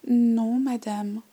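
loud, high, careful polite no; polite little girl. Acted. Girl politely answers the old lady, loud and careful. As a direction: say no like a dutiful child. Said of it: "ai-je répondu poliment".

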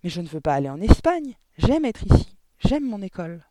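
put-on upset child objecting at full voice; loud protest. A narrator's reading. Girl refusing to leave her home and school, loud protest. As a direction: plead your case loudly, insistent.

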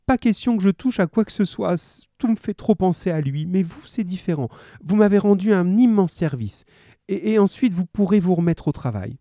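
high-pitched widow's entreaty; pleading. Acted. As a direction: beg them to do it for her. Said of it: "supplia-t-elle".

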